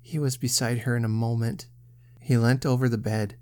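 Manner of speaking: ordinary delivery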